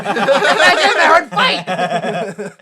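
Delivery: words squeezed out through loud laughter; laughing. Real, not acted. Laughing at his own movie idea, loud laughing. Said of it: "laughing aloud".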